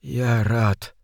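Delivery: put-on weak, whispery rasp of a dying old man; frail, fading deathbed murmur; rustling faint voice. A narrator's reading. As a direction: let the words rustle out faintly. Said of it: "прошелестел он".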